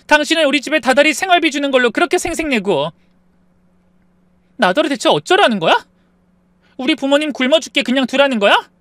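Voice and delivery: very high, loud distraught outburst; voice rising, upset. Acted. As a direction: wail your grievance at your husband. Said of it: "아내의 목소리가 높아지더니".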